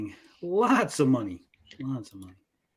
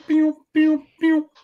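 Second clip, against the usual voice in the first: pew pew; 12 semitones higher, 5 dB louder, slower than usual. Pretend. Sound effect before reading post.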